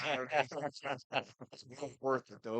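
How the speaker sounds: lost voice from hollering; raspy, worn-out hoarse voice. Real, not acted. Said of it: "I lost my voice out there hooting and hollering".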